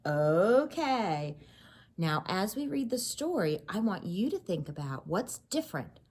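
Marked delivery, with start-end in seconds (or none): none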